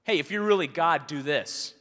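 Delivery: a defiant thief challenging God loudly; taunting challenge. Retold or imitated. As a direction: dare him, chest out. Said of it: "I'm talking to him like he's my equal".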